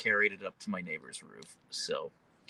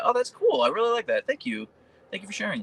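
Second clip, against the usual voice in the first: excited; 11 semitones higher, 4 dB louder than usual. Real, not acted. Excited reply to chat.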